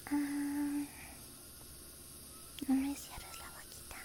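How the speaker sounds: soft, breathy close-mic whisper throughout; tender roleplay dentist whispering to a patient; ASMR whisper. Acted. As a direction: whisper gently like a dentist soothing a nervous patient.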